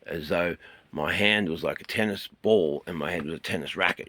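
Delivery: normal talking voice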